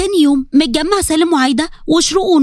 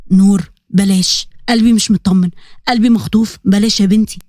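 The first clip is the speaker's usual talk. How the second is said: worried father; 6 semitones lower than usual. Acted. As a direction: plead gently with a heavy heart. Worried father warns daughter.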